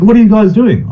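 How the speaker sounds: high, chipper arrival; cheery absent player. Retold or imitated. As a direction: breeze in clueless and upbeat.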